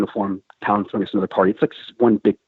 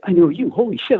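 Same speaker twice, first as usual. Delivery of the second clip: higher-pitched, startled recognition; surprised recognition; 10 semitones higher than usual. Retold or imitated. Navy guy recognizing the Marine, in high-pitched surprise. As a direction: retell his amazed double take, pitch climbing.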